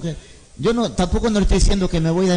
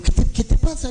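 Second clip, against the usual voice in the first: worried mother; 8 semitones higher than usual. Retold or imitated. Madre preocupada pregunta al niño.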